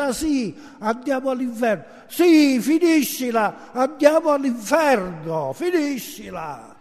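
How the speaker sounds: high, forceful, angry outburst; exasperated shout. Acted. Angry passenger telling his neighbour to stop screaming, exasperated shout. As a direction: shout him down, pitch leaping up. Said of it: "pełen zdenerwowania krzyknął do swojego sąsiada".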